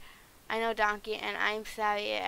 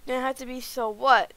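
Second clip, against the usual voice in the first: louder, pointed insults, slowed down; heated; 6 dB louder than usual. Acted. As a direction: throw the insults at him one by one. Heated argument with Shrek.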